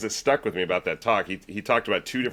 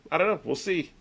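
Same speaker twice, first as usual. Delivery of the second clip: Walter impression; 10 semitones higher than usual. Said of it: "he just kind of is just like".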